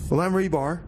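usual speaking voice

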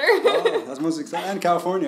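high, loud burst of excitement; excited. Real, not acted. Host thrilled at guest's California move, excited. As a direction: gush with delight at the news.

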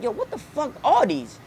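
high-pitched disbelief at new shoes; jaw-drop at the sneakers; amazed. Retold or imitated. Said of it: "I'm like".

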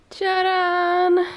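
high, drawn-out ta-da; playful reveal. Real, not acted. Vlogger unveiling the baby's closet to viewers, playful reveal. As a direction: sing it out like a big unveiling.

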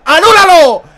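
loud defiant yell; taunting shout. Real, not acted. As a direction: dare the referee loudly. Taunting referee.

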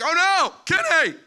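high, loud panicked whine; freaking out. Pretend. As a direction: freak out over the lost axe. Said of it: "and I'd be like".

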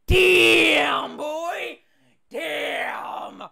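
put-on high shouted Damn, boy, stunned; stunned exclamation. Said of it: "I'm just like".

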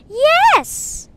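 very high, loud, held cry; shrieked answer. Real, not acted. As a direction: squeal it out at full volume. Shouted answer in game.

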